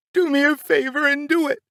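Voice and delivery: high, furious scream, leaning on "do"; mother screaming. Retold or imitated. Mother daring father to pull the trigger, furious high scream. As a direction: spit out "do" with pure defiance. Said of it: "I remember my mother screaming".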